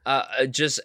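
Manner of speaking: ordinary delivery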